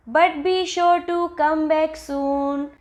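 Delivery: drawn-out sing-song verse; poem recitation. Shown as a demonstration. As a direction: recite evenly like a children's rhyme. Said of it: "so let us recite this".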